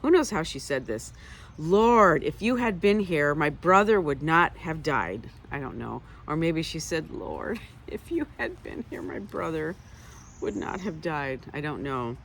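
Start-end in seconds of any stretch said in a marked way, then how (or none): 1.6-5.3 angry. Retold or imitated. Martha scolding Jesus with loud reproach. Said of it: "You could say it angrily". 7.1-11.4 light, laughing and breathy; kindly. Retold or imitated. Martha gently teasing Jesus, kind and laughing. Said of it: "or you could say it so kindly".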